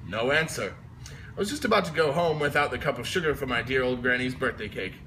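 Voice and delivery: usual speaking voice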